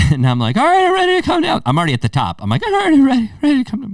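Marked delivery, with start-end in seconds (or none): 0.6-1.6 much higher, fast, voice cracking with fear; cracking scared voice. Retold or imitated. Scared climber at the top of the wall, voice cracking. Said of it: "My voice started to crack". 2.6-3.9 much higher, fast, voice cracking with fear; cracking scared voice. Retold or imitated. Scared climber at the top of the wall, voice cracking. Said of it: "My voice started to crack".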